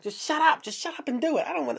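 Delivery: very high-pitched tearful mock protest; panicky mock whine. Patient cutting off the doctor before surgery, whiny mock panic. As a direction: plead frantically, hammed-up near crying.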